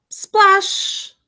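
high splash with a long hiss; big splash. A narrator's reading. Froggy back in the pond, high splash. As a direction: toss it up high. Narrated sound of hitting pond.

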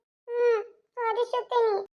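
high, soft tearful sniffle; child promising to wipe her tears, crying. Acted. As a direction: answer through tears like a little girl.

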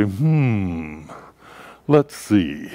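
lightly voiced pondering uncle; long thoughtful hum, then the verdict; Uncle Mark. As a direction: mull it over like a beard-stroker. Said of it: "use the lowest voice he could muster".

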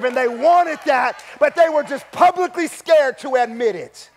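loud, high, shouted sermon climax; fiery preacher driving the point home; preaching at full force. Acted. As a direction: build it to a roaring pulpit cry.

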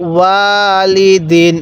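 ওয়ালিদিন very slow, vowels held level; recitation model. Shown as a demonstration. Teacher showing the learner how to read, drawn-out ওয়ালিদিন. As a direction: chant ওয়ালিদিন with a long first syllable. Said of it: "কিভাবে শিখব".

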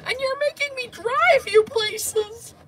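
high, loud, wailing whine; hammed-up teary complaint to mom; whiny mock crying. Retelling crying to mom on the drive, high whiny wail. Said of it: "I'm like".

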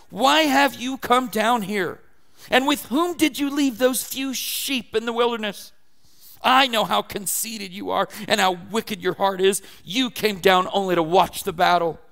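put-on loud, scolding older brother; angry older brother. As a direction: rebuke him hotly, accusing. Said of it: "he burned with anger at him".

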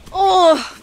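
usual speaking voice

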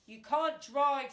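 mocking. A narrator's reading. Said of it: "said Fingers mockingly".

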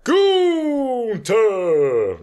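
booming Gunther. Retold or imitated. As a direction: bellow the name like a ring announcer with a German accent. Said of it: "My name is Gunther".

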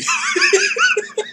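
a very high squeal of laughter. Real, not acted. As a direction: let the laugh shoot up into a squeal. Friend William teases him.

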